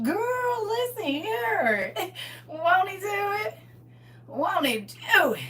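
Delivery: high, slow, overjoyed, half crying; joy spilling over into tears; laughing through tears. Real, not acted. Scene: thanking God for the back pay, laughing and crying with joy.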